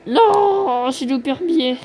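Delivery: high, loud wail of "no", then easing; dismayed cry. Real, not acted. Player laments a missed banknote, dismayed cry.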